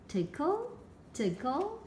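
teasing rise on each tickle; light, climbing singsong; playful tickling. A narrator's reading. Izzy teasing the tiger with a feather, playful rising voice.

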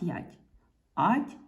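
usual speaking voice

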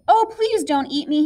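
mildly fearful begging; frightened pleading. A narrator's reading. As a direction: plead like a scared little goat, lightly acted. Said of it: "Little Billy Goat Gruff was afraid".

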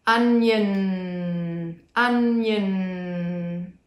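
Onion, slow, the final n held out long. Shown as a demonstration. Therapist shows the n sound to a client, slow long n. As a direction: drag out the n in Onion. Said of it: "Can you hear my n?".

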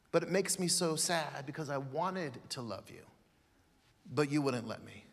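soft and low, sinking sadly; heartbroken. Acted. Jesus grieving his rejected love, soft low heartbreak. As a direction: let it break quietly. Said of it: "this is what made Jesus cry".

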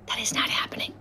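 unvoiced whisper. Real, not acted. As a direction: whisper it flatly. Whispered retort.

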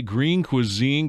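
ordinary delivery

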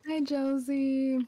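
high, slow sing-song hello; drawn-out friendly greeting to a viewer; sweet greeting. Real, not acted. Streamer greets viewer Josie.

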